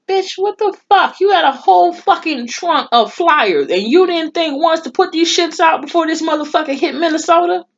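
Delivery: furious rant. Acted. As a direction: chew him out over the unused flyers. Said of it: "I'm like".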